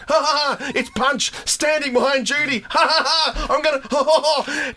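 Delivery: theatrical melodrama villain laughing; vaudeville villain laugh. Retold or imitated. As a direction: ham it up like a moustache-twirling villain. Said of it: "like a villain in vaudeville twirling his moustache".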